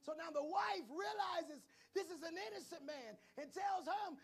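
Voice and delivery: fired-up pulpit preaching. Acted. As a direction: build the sermon into a holler. Preaching to the congregation.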